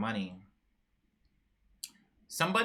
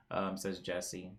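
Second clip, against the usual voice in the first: ordinary delivery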